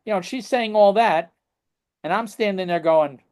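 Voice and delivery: ordinary talk, nothing marked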